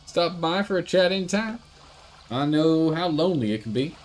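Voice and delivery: lightly voiced dock worker. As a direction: play the dock worker pleased with the catch.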